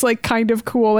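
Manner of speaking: ordinary delivery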